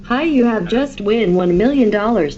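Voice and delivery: ordinary delivery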